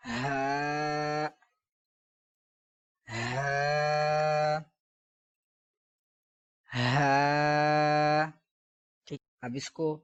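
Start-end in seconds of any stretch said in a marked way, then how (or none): 0.1-8.3 long open ہا; correct pronunciation. Shown as a demonstration. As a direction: breathe into ہا and hold the "aa". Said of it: "بلکہ".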